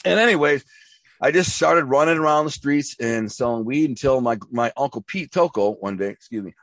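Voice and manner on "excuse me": while sipping a drink. Real, not acted. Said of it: "I'm drinking a protein shake".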